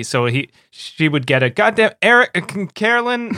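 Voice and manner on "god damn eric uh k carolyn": loud, high, flustered mock scolding; imitating a dad's exasperated outburst, fumbling the names; imitated parental yell. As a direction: yell the wrong name, stumble, then correct it. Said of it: "reaction would be to yell at me".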